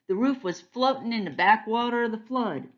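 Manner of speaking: plain reading voice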